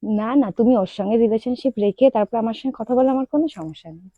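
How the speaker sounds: ordinary delivery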